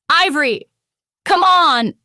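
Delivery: high, loud urgent call; shouted. A narrator's reading. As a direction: shout to get her moving. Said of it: "Flint shouted".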